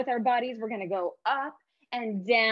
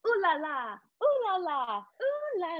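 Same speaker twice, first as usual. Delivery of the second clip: rising silly sing-song, climbing high and slow; 9 semitones higher, slower than usual. Shown as a demonstration. Teacher as Lily, silly sing-song. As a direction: ham it up, shaking your hips. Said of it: "get really silly".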